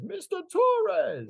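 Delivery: high, drawn-out, condescending "Mister Torres"; arrogant voice. Retold or imitated. Imitating the arrogant customer greeting the chef. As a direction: look down your nose as you say the name. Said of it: "And his arrogant voice".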